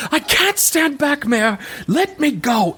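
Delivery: high, strained cry; desperate scream. Acted. Pinned woman guard pleading with the mayor, desperate high scream. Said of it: "this woman just screams".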